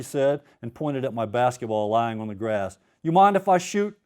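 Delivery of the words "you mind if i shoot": put-on Cajun accent. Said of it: "I get to do my Cajun accent".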